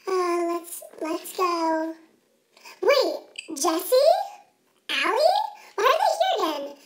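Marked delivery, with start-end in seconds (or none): none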